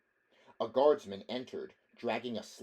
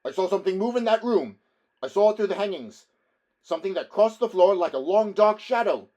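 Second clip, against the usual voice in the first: higher, louder urgent report; urgent alarm; 10 semitones higher, 14 dB louder, faster than usual. Acted. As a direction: urge them to look. Barbarian warns of lurking thing.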